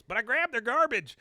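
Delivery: high, loud comic announcement; triumphant shout. Acted. Bobby reveals the garbage bag to the spies, triumphant shout.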